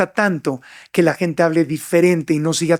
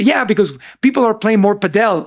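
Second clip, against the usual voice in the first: quoting a gringo partner in English; imitated American speaker, "padel" stressed at the end; 2 semitones higher than usual. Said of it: "hablando inglés, dice padel".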